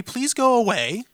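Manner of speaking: mock polite request. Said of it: "Asked the Indians very nicely".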